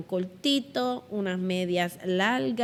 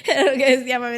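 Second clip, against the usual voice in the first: louder, laughing through the words; laughing while talking; 4 semitones higher, 8 dB louder, much faster than usual. Real, not acted. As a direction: keep laughing as you speak.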